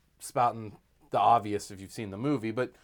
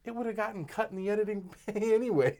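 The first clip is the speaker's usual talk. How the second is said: quoting director; 10 semitones higher, faster than usual. Retold or imitated. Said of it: "And he's like".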